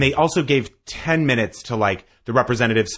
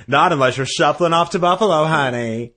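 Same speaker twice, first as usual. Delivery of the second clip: high, louder camp sass; sassy; 5 semitones higher, 3 dB louder than usual. Pretend. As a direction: sass it like a diva.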